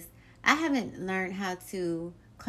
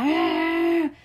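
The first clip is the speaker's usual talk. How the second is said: frustrated growl; 8 semitones higher, 6 dB louder than usual. Retold or imitated. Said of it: "and I'm like".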